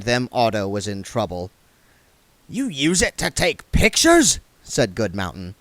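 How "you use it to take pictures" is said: dwarf voice. A narrator's reading. Goodmountain voiced.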